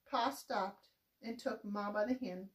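ordinary delivery, nothing marked

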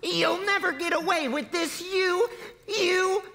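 put-on high-pitched child's outburst; screaming child. A narrator's reading. Younger child to the gunman, high screamed defiance. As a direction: pitch it way up as an upset little kid. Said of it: "screamed the younger child".

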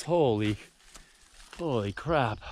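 ordinary talk, nothing marked

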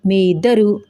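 plain reading voice

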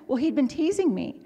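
normal talking voice

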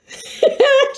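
high, loud burst of laughter; real laugh burst. Real, not acted. Cracking up while telling about the laughing exercise, high laugh. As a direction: crack up mid-story.

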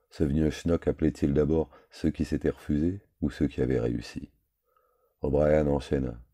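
usual speaking voice

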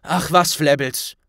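snapping at the elf. Acted. Ron snaps at the little house-elf. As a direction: brush the elf off sharply. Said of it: "Ron den kleinen Elfen anfuhr".

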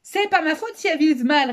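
high, loud sniggering protest; mocking boy, loud and high; sneering. A narrator's reading. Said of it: "ricana Frédéric".

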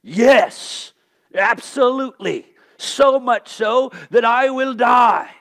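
defiant insistence. Retold or imitated. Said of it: "what does he say".

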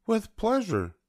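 very high airy flamingo; posh flamingo voice. Acted. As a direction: answer in a high, grand flamingo voice. Flamingo answers.

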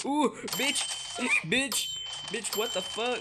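high, loud startled reaction; startled outcry. Real, not acted. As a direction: cry out as if suddenly ambushed. Startled by enemies in the game.

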